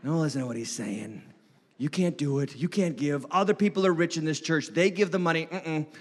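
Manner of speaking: quietly discourage, like a thought in the head; little inner voice. Acted. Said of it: "that little voice in the back of your head".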